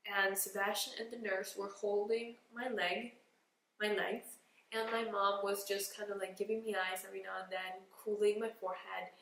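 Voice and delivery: usual speaking voice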